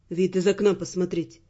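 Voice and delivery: low, softly mocking man's voice. A narrator's reading. Raymond inviting the heroine to his room, low and mildly mocking. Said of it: "насмешливо пояснил он".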